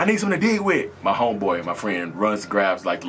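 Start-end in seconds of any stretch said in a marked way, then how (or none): 0.0-0.9 high-pitched call for help; retold urgent plea. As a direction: replay an urgent request, pitched way up. Retelling urgent call for tool.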